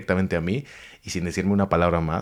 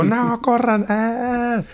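high, loud fleeing yelp; hammed-up scramble to run away; mock panic; 3 dB louder, much slower than usual. Crowd flees in panic.

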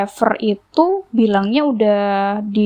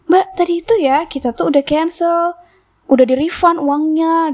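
higher-pitched mock protest; retold protest; 7 semitones higher than usual. Customer tells the driver it was cancelled, retold protest. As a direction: argue your case in a higher voice.

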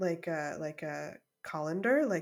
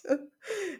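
high laugh; 13 semitones higher than usual. Real, not acted.

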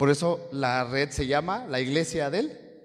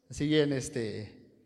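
normal talking voice

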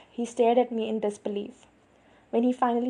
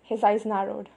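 usual speaking voice

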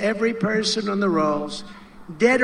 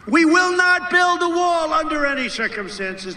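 mock official pledge; 6 semitones higher than usual. Mock hypothetical statement he should have made.

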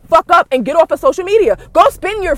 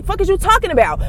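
ordinary talk

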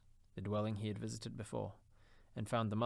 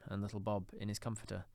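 ordinary delivery

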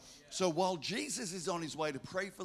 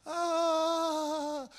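sudden high startled "ah"; 12 semitones higher, 5 dB louder than usual. Retold or imitated. Pastor caught off guard by a stranger, startled cry. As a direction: jolt of surprise, way up high. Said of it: "And so I'm like".

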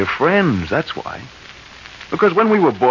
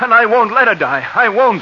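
higher, pushing harder each refusal; desperate insistence; 7 semitones higher than usual. Acted. Husband begging the banker, rising desperation. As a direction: refuse to give up, voice climbing. Refusing to let wife die.

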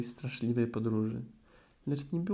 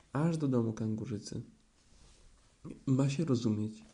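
usual speaking voice

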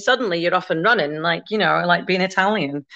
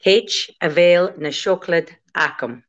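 slow, word-by-word Gaelic; slow demonstration; slower than usual. Shown as a demonstration. Teacher repeats the Gaelic phrase for her pupil, slow demonstration. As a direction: say it slowly for a learner to copy. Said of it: "Okay, say it again".

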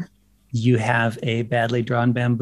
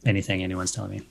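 ordinary talk, nothing marked

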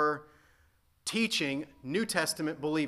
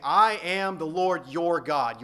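very slow and a bit louder, each word weighted; slow solemn proclamation; 3 semitones higher, 8 dB louder, slower than usual. Acted. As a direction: proclaim it like God speaking. Voicing God's authority.

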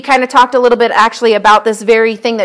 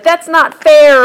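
put-on high, loud, slow child's protest; hammed-up child complaint; mock kid shout; 5 semitones higher, 4 dB louder, slower than usual. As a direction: whine it like a kid who lost. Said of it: "and they shout".

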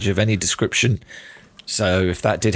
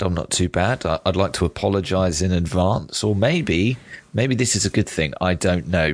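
normal talking voice

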